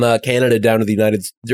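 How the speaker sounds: ordinary delivery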